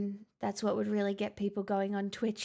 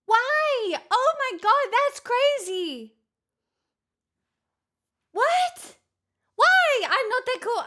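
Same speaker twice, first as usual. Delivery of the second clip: shocked yelp; 12 semitones higher, 9 dB louder, slower than usual. Real, not acted. Shocked by a big hype train.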